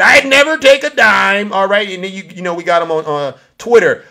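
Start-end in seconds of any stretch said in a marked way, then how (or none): 0.0-1.5 high, loud mocking quote; Donald Trump. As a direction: mimic a boastful politician, pitched high. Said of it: "This is the same guy that said".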